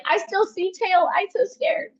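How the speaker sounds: high little-girl voice, playing frightened; drawn-out, high-pitched mock toddler fear; imitating scared toddler. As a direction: mimic a scared two-year-old spotting danger. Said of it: "And she's like".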